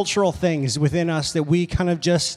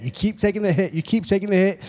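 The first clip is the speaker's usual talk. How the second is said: ordinary talk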